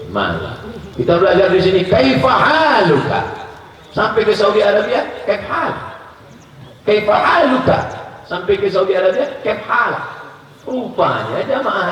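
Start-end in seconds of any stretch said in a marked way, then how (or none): 1.0-9.9 excited. Acted. Teasing contrast of Arabic greetings.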